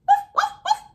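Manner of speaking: high, loud yappy little woofs; tiny dog barking. Acted. Tiny dog barks at Bam and Jam, high yaps. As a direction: yap like a very small dog. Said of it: "The tiny dog barked".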